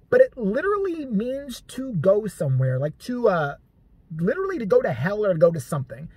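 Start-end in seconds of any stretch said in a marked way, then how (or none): none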